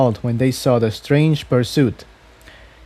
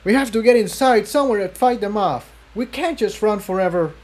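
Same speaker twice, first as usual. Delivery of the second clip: put-on a higher, urgent young colt voice; Jigsaw; 9 semitones higher than usual. A narrator's reading. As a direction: urge her on mid-chase. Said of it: "Jigsaw said".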